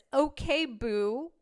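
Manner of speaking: teasing mock sweet-talk; mock sassy. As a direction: imitate a sassy commenter. Mockingly reading his sassy comment.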